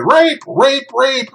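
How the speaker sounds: high mock screams for help; mock woman's scream. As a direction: shout it like the victim, high and pleading. Woman screaming in retold scenario.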